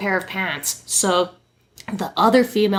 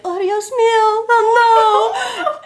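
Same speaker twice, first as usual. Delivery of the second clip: high, loud imitated sob of panic; imitating a panicked crying model; mock crying Spanish; 13 semitones higher, 5 dB louder, slower than usual. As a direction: wail it like the hurt model. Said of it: "in between crying".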